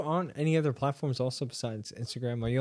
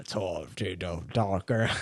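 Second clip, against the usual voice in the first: lizard voice try; 6 semitones lower, slower than usual. Shown as a demonstration. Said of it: "I can do that".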